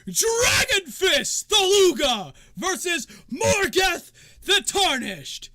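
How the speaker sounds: high, booming, slow fighter introductions; arena announcer. Acted. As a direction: stretch each name out to the crowd. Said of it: "and then he goes".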